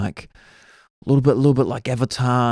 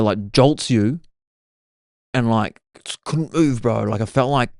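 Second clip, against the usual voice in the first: ordinary talk, nothing marked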